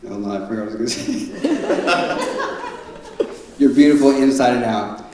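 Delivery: normal talking voice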